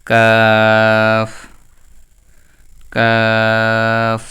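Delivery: very slow held কাফ; long drawn kaf. Shown as a demonstration. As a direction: drill কাফ for the class. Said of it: "আমরা কাফ লিখি". (কাফ টেনে টেনে)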